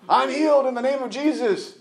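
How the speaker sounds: high, bright-eyed mock claim of healing; mock positive confession. Preacher mocking positive confession, high mock triumph. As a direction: parody a believer faking a cure. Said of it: "Positive confession would be".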